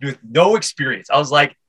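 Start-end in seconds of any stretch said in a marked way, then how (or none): none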